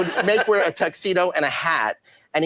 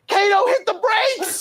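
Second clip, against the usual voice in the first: high-pitched mock shout, drawn out; 3 dB louder, slower than usual. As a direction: imitate a star shrieking an order at his driver.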